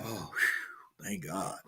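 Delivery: relieved breathy whisper; sigh of relief. Retold or imitated. Father relieved after fearing the worst, whispered. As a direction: let it out on a soft relieved breath. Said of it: "I kinda had a sigh of relief".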